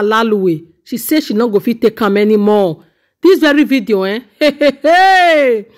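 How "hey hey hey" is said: high, drawn-out "hey" after a laugh; amazed exclamation. Real, not acted. Commentator reacting to the video, amazed exclamation.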